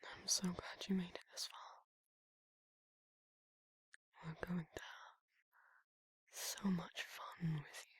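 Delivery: intimate whisper, close to the ear; soft ASMR whisper. Acted. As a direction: murmur tenderly to a sleepy listener. Whispered ASMR roleplay to listener.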